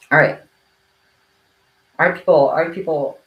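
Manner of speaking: loud teacher call to order; a loud mock teacher's voice; teacher calling class. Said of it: "I'm gonna do the teacher thing".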